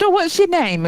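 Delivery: a lightly voiced friendly cabbie; chatty driver. Driver asks the shy girl her name, brightly.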